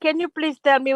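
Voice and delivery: ordinary delivery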